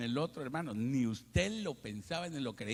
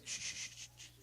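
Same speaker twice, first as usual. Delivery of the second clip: soft hissed shh calls; hissed shushing; 11 dB quieter than usual. Retold or imitated. Diner shushing to call the waiter, soft hiss.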